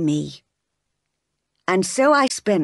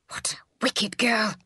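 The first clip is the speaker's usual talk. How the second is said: hushed, scandalised exclamation; shocked whisper; 3 semitones higher, 6 dB quieter than usual. Acted. Servants reacting to Jane's attack, scandalised whisper. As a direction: whisper it in horror.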